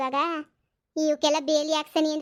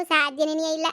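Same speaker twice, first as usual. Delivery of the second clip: ordinary delivery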